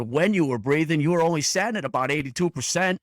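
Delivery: put-on high, loud Boston accent. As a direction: keep the Boston accent going. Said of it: "in that Boston accent".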